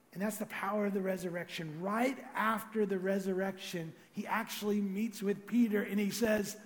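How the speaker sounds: ordinary delivery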